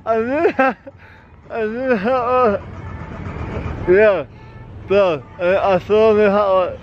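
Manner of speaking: talking with tongue stuck; words mangled by stuck tongue; tongue stuck to pole. Real, not acted.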